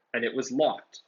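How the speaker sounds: plain reading voice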